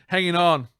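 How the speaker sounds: mock the slip, landing hard on "on"; exaggerated misreading. Shown as a demonstration. Reader replaying his slip, "Hanging on" overdone. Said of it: "I did it again".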